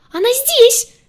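very high, loud squeal; panicked shriek. A narrator's reading. Green one warns the others she is here, shriek. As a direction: squeal it out in alarm. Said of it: "взвизгнул зелёный".

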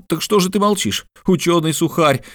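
high-pitched, exasperated outcry; impatient exclamation. A narrator's reading. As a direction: exclaim it high, demanding an answer. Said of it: "воскликнул Мишель Ардан".

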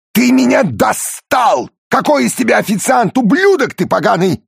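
furious high yelling Italian boss; enraged boss roar. Acted. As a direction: scream the insults at the waiter. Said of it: "вспыльчивый итальянец".

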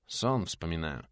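low, soft, slow reply; quiet, slow indifference; indifferent. A narrator's reading. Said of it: "равнодушно помешивая бурду".

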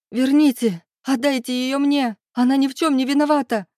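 high, desperate cry; tearful scream. A narrator's reading. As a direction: plead at the top of your range, near sobbing. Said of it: "Зарыдав, прокричала я".

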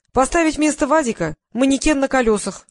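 high, loud ready suggestion; rattled-off answer. A narrator's reading. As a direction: reel off the idea briskly and brightly. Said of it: "отбарабанила помощница".

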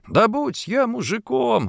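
high, drawn-out indignant exclamation; heated outburst. A narrator's reading. Wife flaring up in front of her husband, heated outburst. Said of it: "распалилась Ефросинья".

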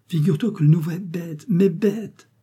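a schoolboy eagerly telling his mother; child's voice. Acted. Little boy telling mother about the new pupil, eager child voice.

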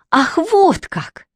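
high, drawn-out outraged exclamation; stretched, high-pitched outburst; indignant cry. A narrator's reading. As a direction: cry out slowly, stung. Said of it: "закричала девушка".

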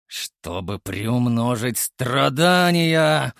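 slow villain line, loud cackle; evil laugh. Pretend. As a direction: ham up a mustache twirling villain. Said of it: "Это зловещий смех".

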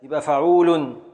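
ordinary talk, nothing marked

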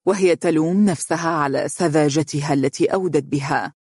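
usual speaking voice

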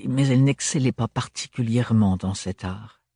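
ordinary delivery, nothing marked

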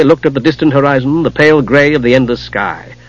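usual speaking voice

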